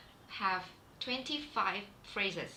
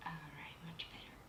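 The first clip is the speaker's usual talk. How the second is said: whispering; 4 semitones lower, 13 dB quieter than usual. Real, not acted. Adjusting camera setup mid-stream.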